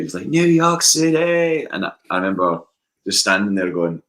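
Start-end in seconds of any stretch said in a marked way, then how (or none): 0.3-1.7 high, loud, drawn-out crowd-rousing shout; imitated singer's shout. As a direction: shout it long and high like a frontman onstage. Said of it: "he just shouted".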